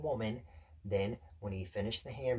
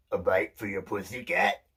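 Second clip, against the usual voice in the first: louder, put-on vendor voice; benign burger seller; 6 dB louder than usual. Burger man handing over the meat, benign put-on voice. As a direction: ask it as a kindly shopkeeper's character voice. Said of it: "he smiled benignly".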